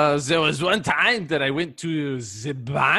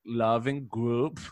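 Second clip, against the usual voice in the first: mock soothing; 9 semitones lower, 12 dB quieter than usual. Soothing support.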